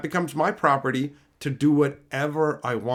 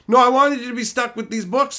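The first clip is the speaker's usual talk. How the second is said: mock whiny gifter; 8 semitones higher, 5 dB louder than usual.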